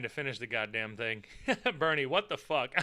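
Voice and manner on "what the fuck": ordinary delivery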